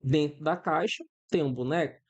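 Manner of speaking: usual speaking voice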